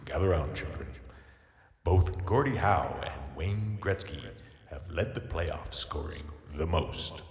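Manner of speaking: put-on slow, soft storyteller to children; lightly voiced old storyteller; slow wise elder. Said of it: "take us home with a little story".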